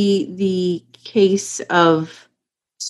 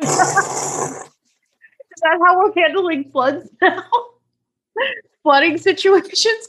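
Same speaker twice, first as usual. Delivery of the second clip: laughing; 9 semitones higher than usual. Real, not acted. Joking about watermen.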